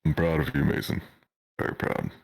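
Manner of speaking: ordinary talk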